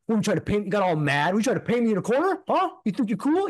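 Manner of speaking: a touch of a riled-up agent snapping back; mocking angry agent. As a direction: imitate a defensive guy getting huffy. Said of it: "he's like".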